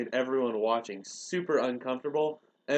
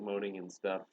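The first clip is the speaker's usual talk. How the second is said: ordinary talk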